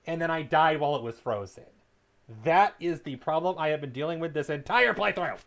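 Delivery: frustrated rant. Real, not acted. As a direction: vent about the frozen game. Game froze, ranting.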